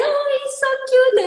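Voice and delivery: put-on sing-song high coo over a baby; cooing visitor. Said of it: "everybody comes and say".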